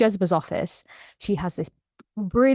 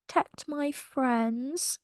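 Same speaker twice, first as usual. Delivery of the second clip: softer, slow murmur on the edge of tears; tearful echo; 3 semitones higher, 9 dB quieter, much slower than usual. Real, not acted. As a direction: repeat it quietly, choked up. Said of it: "Such a cutie".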